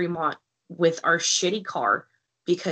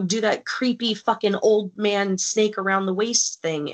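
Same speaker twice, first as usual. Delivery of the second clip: ordinary talk, nothing marked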